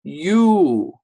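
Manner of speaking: "U" called out high and loud; long u sound. Shown as a demonstration. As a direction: sing out the vowel "U". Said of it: "the sound it makes is".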